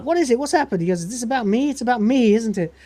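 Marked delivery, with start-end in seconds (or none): 0.0-0.8 higher, urgent questions; alarmed crew. Acted. The crew demands to hear the bad news, alarmed and high. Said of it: "everyone says". 1.0-2.7 higher, paranoid whine; Rimmer. Acted. Rimmer fears the news concerns him, higher paranoid whine. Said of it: "that's Rimmer".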